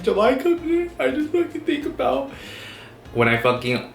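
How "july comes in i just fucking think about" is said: mock tearful whine. Mock crying over lasting trauma.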